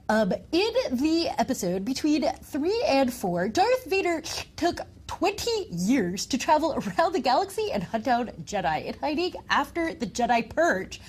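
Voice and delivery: stuffed-up nerd with allergies, hammed up. Co-host reads a viewer comment on request, mock allergic nerd voice. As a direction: put on a congested nerd voice, pitch jumping about. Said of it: "read this in your best nerd voice".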